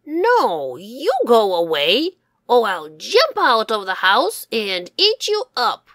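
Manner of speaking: loud, snarling fox threat; threatening fox. Acted. Fox shouting at the wolf from the house, loud and menacing. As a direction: growl it out to scare them off.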